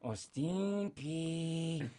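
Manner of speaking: slow, drawn-out creepy Lorre purr; Peter Lorre voice. Acted. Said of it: "like the German expressionistic, Peter Lorre".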